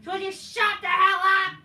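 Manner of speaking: high, loud sing-song mockery of a wrestler's promo; shrill-free mocking sing-song of Rollins yelling at a rival. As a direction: whine it out high and loud to ridicule him. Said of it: "the fucking fact that Rollins like".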